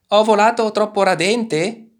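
high, loud calling voice; shouted question. A narrator's reading. Turtle asking the animals mid-flight, high shout. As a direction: holler the question down to them. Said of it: "gridò".

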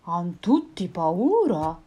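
slow, strange monster voice; puzzled monster. Acted.

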